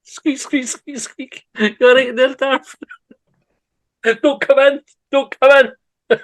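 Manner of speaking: high, loud laughing squeaks and pleas; laughing hard. Real, not acted. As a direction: crack up through it. Laughing squeak and Vader reply.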